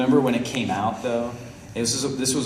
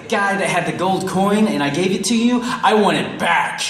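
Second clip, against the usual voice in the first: higher insistent retelling, stretched at the end; acted demand; 6 semitones higher, 4 dB louder than usual. Storyteller demanding gold coin from beggar, insistent. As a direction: demand it, leaning hard on the last words.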